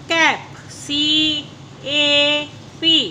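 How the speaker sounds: slow letter-by-letter spelling. Shown as a demonstration. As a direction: spell C A P slowly, each letter apart. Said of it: "teacher spells word slowly".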